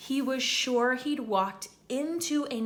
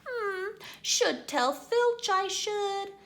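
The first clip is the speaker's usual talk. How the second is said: high, slow, falsely pious; saintly voice; 11 semitones higher, slower than usual. Acted. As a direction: pretend to be virtuous, slowly. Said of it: "in a saintly voice".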